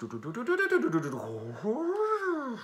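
high "tututu" synth sound; synth noise imitation. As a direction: mouth the wobbling synth notes.

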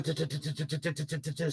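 soft sputtering engine noise from the tongue; motorcycle sound. Retold or imitated. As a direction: imitate a motorbike rattling along quietly. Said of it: "so it makes a cool t".

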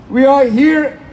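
usual speaking voice

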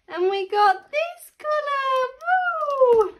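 slow, very high singsong reveal; excited squeal. Real, not acted. Mum reveals last colour to toddler, squealing. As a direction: top the reveal with a gleeful woo.

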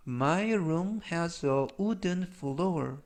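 slow, carefully spaced English model sentence; language-lesson model reading. Shown as a demonstration. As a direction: read it slowly for learners to repeat. Model sentences read for learners.

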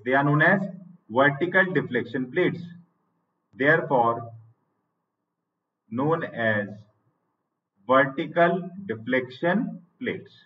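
each phrase said very slowly and spaced out for students to write down; teacher dictating notes at writing speed; slow dictation. Shown as a demonstration.

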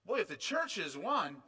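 usual speaking voice

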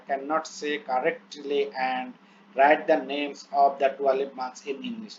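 very slow, word-by-word English reading; slow dictation reading. Shown as a demonstration. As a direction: read each English word out slowly as if dictating. Reads English plan aloud slowly. (ধীরে ধীরে শ্রুতলিপির মতো পড়া)

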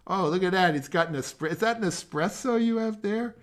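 light up at the sight of the espresso; a spark of real delight; excited. Real, not acted. Noticing the student's espresso on camera.

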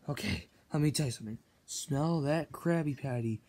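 dreamy hush over the patty; hushed coaxing. Acted. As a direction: coax him in a low hush. Coaxing SpongeBob to smell patty.

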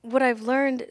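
usual speaking voice

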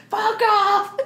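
high, loud retold yell; mock shouted outburst. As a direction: yell it as the woman shooing off the priest. She snaps at the priest.

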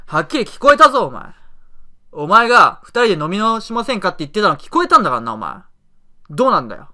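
emotional angry. Shown as a demonstration. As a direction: put real anger into it. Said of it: "感情込めて言おうか". (感情を込めて怒鳴る)

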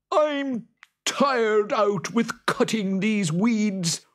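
high, loud hammed-up Boggart. Boggart snapping at the farmer, high and loud. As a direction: squeal it out like a cross little monster.

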